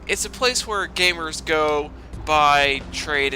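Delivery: ordinary talk, nothing marked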